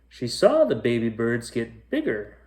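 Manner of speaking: ordinary delivery, nothing marked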